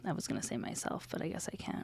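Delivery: unvoiced whispered joke. Real, not acted. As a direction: murmur the cheeky aside. Jokey aside.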